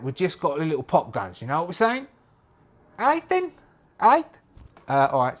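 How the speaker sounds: a hint of a blunt, stern gang leader; lightly voiced tough leader. Staff warns Jack he'll be left behind, a lightly voiced stern leader. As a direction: lay down the rules, clipped and firm.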